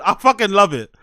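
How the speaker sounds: ordinary talk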